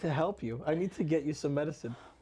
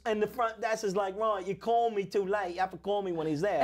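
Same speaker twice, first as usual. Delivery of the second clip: put-on English accent; 2 semitones higher than usual. Front desk scolding a late caller, English accent. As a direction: ham up a Cockney-ish front desk. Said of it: "I love doing the English accent".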